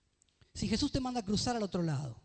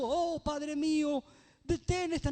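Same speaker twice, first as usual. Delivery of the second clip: higher, very slow imploring plea; mock pleading prayer; 6 semitones higher, slower than usual. Preacher showing what Jesus did not pray, mock pleading. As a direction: beg like a frightened prophet. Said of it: "Él no dijo".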